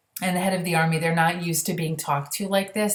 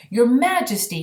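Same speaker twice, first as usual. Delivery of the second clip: a louder, higher furious appeal; angry cry; 7 semitones higher, 6 dB louder than usual. Acted. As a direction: burst out at the Queen. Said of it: "he cried".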